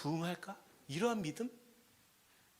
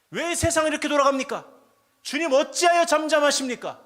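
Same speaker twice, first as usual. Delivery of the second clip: loud, high anguished cry; crying out to God; 9 semitones higher, 13 dB louder than usual. Acted. Believer crying out to silent God, loud and anguished. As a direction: demand an answer from heaven. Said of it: "질문하십니까, 주님 앞에?".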